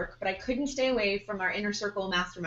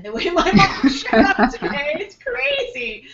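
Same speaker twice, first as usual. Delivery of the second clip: laughing; 7 semitones higher, 11 dB louder than usual. Real, not acted. Laughing while telling about her mom.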